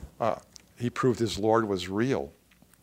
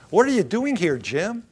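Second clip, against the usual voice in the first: higher, louder challenge; acted divine challenge, pitched up; confronting question; 8 semitones higher, 5 dB louder than usual. God asks the preacher what he is doing, urgent confrontation.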